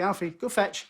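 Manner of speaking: cheerful "go fetch" to a pet; calling the dog. Acted. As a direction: send the dog off with an eager command. Owner calling his dog.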